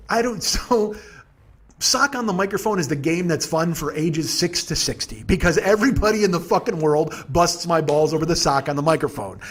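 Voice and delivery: normal talking voice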